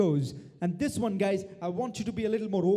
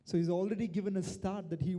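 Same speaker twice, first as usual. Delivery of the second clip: normal talking voice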